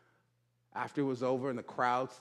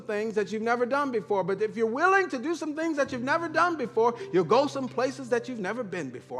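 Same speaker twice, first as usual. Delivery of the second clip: fast, high motivational patter; Les Brown; 8 semitones higher, faster than usual. Retold or imitated. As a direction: rattle off the challenge in one rousing run. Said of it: "He said".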